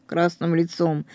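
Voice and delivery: usual speaking voice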